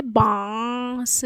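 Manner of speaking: बांस with long nasal आ before स; nasalised vowel demo. Shown as a demonstration. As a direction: stretch बांस through mouth and nose. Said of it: "इसको भी उच्चारित करेंगे".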